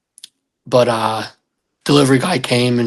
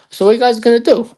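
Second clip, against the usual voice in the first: higher, louder probe; pointed question; 10 semitones higher, 4 dB louder, faster than usual. Retold or imitated. Asking his girlfriend about her plans, high pointed probe. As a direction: push to find out.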